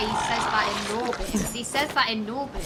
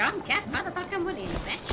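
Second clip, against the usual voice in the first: higher, very fast, cocky mock boast; Katt Williams bravado; 6 semitones higher, 5 dB quieter, faster than usual. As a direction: brush it off like nothing can touch him.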